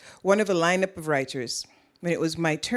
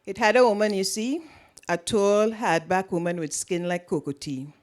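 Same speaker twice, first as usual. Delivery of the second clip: Trinidadian accent. Shown as a demonstration. Author reads her novel's opening to the audience in Trinidadian accent. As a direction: give the opening paragraph in full Trinidadian vernacular and accent. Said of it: "I'll switch back into more standard English now".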